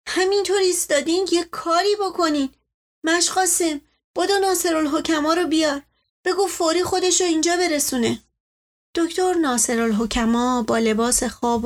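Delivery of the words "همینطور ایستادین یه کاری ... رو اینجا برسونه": higher, pressing plea for help; a panicked mother ordering the servant to run; urgent plea. Acted. Mother urging Mash Qasem to fetch the doctor, panicked.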